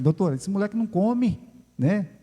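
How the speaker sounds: worried parent to the doctor, high; worried mother. Retold or imitated. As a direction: complain to the doctor about the boy.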